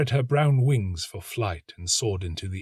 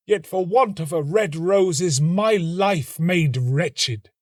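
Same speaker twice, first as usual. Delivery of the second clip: high, slow lament, louder; despairing young student; 9 semitones higher, 5 dB louder than usual. A narrator's reading. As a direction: cry out against fate, high and drawn out.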